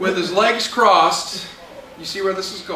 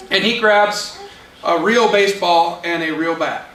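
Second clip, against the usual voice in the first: ordinary talk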